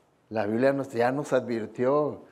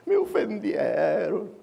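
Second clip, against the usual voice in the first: whiny offended voice; 7 semitones higher, slower than usual. Retold or imitated. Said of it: "Saldría corriendo llorando".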